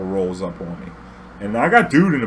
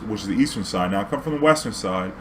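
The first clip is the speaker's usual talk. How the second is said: normal talking voice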